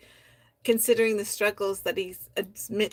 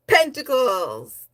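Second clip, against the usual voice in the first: playful call; 5 semitones higher, 8 dB louder, much slower than usual. Real, not acted. Teasing the poet with nickname.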